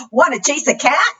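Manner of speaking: high, quick inviting question; storybook dog. Acted. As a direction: lightly voice an eager dog. Reader voices the dog's question.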